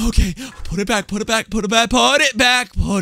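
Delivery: fast, high, urgent pleading; frantic. Real, not acted. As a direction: rush out the plea in panic. Frantic plea after the scare.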